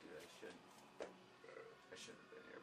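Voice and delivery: whispered regret to a friend; a friend muttering after losing his seat; hushed mutter. Retold or imitated. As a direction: keep it under your breath.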